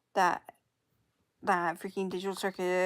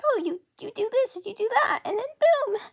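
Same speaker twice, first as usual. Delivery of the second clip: much higher, bright breezy sell; 14 semitones higher, 6 dB louder than usual. Pretend. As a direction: make it sound effortless and fun. Voicing easy app.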